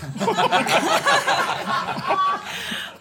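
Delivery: long high-pitched laugh. Real, not acted. Group laughing at own joke.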